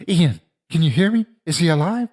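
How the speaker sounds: high, urgent yelling; alarmed yell. A narrator's reading. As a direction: yell out to check on a friend. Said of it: "I yelled".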